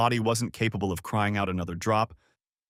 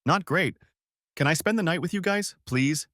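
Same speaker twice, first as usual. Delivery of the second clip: pleading; 7 semitones higher than usual. A narrator's reading. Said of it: "I pleaded, my voice shaking".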